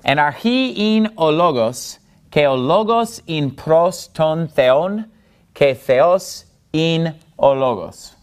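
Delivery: measured Greek, "Anarchi" stressed on its last syllable; stress on accented syllables. Shown as a demonstration. Teacher modelling the Greek verse, stressing every accented syllable. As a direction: lean on each accent, "ologos" stressed on the middle syllable. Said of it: "I'm putting the stress or the accent on".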